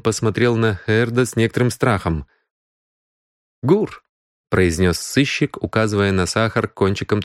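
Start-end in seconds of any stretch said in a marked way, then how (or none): 3.6-4.0 sharp accusing. Acted. Detective springs the word on suspect.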